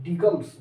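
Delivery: digms with a hard g sounded; wrong reading "digums". Shown as a demonstration. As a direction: mispronounce the ending as "dig-ums". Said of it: "डिगम्स नहीं पढ़ना".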